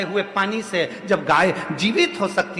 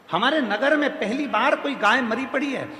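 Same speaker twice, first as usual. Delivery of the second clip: high-pitched, continuous outcry; acted dismay, voice pitched up; alarmed report; 6 semitones higher than usual. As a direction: raise the alarm in one high, unbroken run. Same news repeated as alarmed cry.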